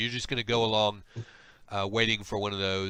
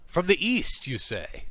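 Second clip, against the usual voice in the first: intrigued; 6 semitones higher than usual. Acted. Slug questions stranger's origin.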